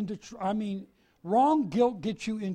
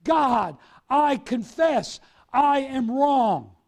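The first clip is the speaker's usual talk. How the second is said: louder, slow, crying out to God; loud confession; 5 semitones higher, 9 dB louder, much slower than usual. Acted. Believer openly confessing sin to God, slow loud cry. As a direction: make the confession heard, drawn out. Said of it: "You make it well known".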